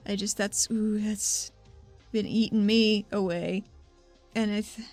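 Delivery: normal talking voice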